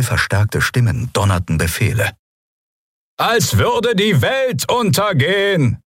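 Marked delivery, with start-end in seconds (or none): 3.2-5.8 high, drawn-out full-voiced cry; commanding outcry. A narrator's reading. A voice thundering over an angry crowd, high drawn-out cry. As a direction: cry it out over a crowd, every word stretched.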